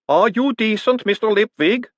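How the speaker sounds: high, booming call through the door; boomed. Acted. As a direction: boom it politely through the door. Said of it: "a voice boomed".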